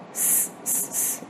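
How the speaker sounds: "s, s, s" as separate voiceless hisses; isolated S sound. Shown as a demonstration. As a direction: sound out each "s" alone, softly. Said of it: "the sound is repeated".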